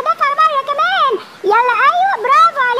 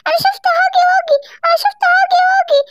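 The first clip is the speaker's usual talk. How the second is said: higher mock child voice; mother copying her son in a higher voice; imitating her son; 6 semitones higher than usual. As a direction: mimic the kid's scared claim. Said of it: "سيمبا بيقول لي".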